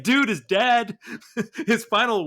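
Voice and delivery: high, loud, laughing. Real, not acted. Discussing the comic's ending, a high, loud, laughing remark. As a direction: laugh at how grim the ending is.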